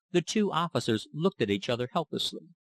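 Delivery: ordinary delivery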